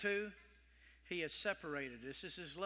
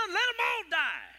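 furious shout; 12 semitones higher, 12 dB louder than usual. Acted. Preacher voicing the crowd, furious shout. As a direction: scream it out high and loud.

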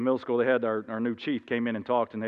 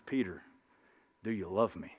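grave question; 5 semitones lower, 9 dB quieter than usual. Acted. Jesus asks second time intently.

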